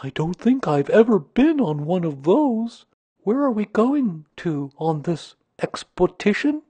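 high, eager bear voice; Pooh, eager. A narrator's reading. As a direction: ask keenly in a high character voice. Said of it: "said Pooh eagerly".

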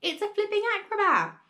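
excited shout. A narrator's reading. Grandma shouting down from the rooftop, high excited shout. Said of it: "Grandma shouted from the rooftop".

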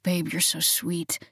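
slow, hushed male whisper. A narrator's reading. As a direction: murmur it close to her ear. Lover whispering in bed.

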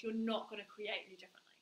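hushed whispered warning; soft secretive whisper. Acted. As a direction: drop to a whisper.

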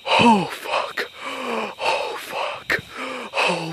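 breathless scared whisper; whispered curse in the dark; frightened whisper. Real, not acted. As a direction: hush it, terrified of being heard. Sees something in the dark.

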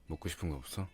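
normal talking voice

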